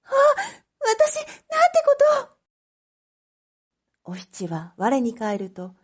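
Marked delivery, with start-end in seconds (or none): 0.8-4.1 very high, broken gasp of horror; a girl realising what she's done; horrified girl. Acted. Oshichi after setting the fire, high and broken.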